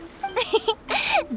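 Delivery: high rising giggle, delighted; happy giggle. Acted. Yoimiya giggling at Paimon's praise of the firework.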